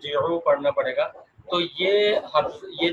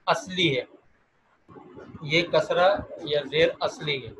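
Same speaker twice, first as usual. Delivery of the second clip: ordinary delivery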